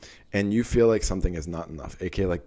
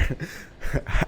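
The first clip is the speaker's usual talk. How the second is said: short breathy huff; 6 semitones higher, 5 dB quieter than usual. Shown as a demonstration. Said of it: "added noise for effect".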